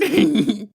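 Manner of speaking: high shrieking cackle; sinister high laugh; creepy high cackle. A narrator's reading. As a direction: cackle like a gleeful clown. Clown lady laugh.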